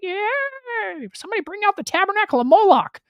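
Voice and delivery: very high, loud mock cheer; squeaky hammed-up party voice; mocking the people. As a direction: cheer like a silly crowd. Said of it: "They're like".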